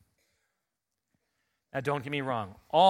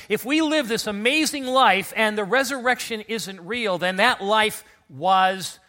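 rising intensity; 5 semitones higher, 6 dB louder than usual. Real, not acted. Preacher builds up.

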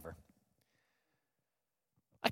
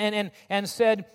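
ordinary delivery

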